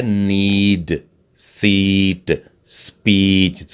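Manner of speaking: सीट and speech drawn out slowly to show the long ई; slow vowel demo. Shown as a demonstration. Teacher slowly modelling सीट and speech. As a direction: stretch सीट and speech so the ई rings. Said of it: "ई की sound".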